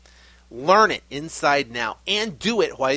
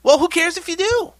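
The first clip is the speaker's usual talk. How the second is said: exclaimed challenge; 9 dB louder than usual. Real, not acted. Coach dismissing fear of failure.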